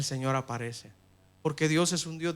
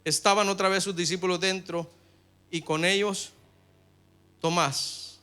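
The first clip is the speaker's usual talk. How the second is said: raised, measured reading of the verse; louder, higher Bible reading; scripture reading; 5 semitones higher, 8 dB louder than usual. Acted. Reads scripture aloud.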